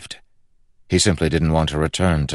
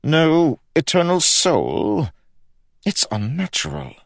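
grumbling king; 13 semitones higher, 4 dB louder than usual. A narrator's reading. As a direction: object as a scandalised king. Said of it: "grumbled the king".

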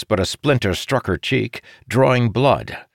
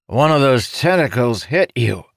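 character voice; 5 semitones higher than usual. A narrator's reading. Necromancer explains during battle.

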